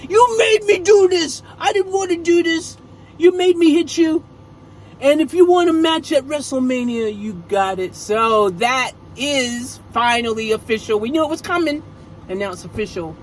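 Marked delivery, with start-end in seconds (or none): 0.1-7.9 high, loud mock taunt over a beaten foe; Rhea's victory taunt. Wrestler taunting her beaten rival, voiced high and loud. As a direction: champion gloating after the knockout. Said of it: "knocked him out and said".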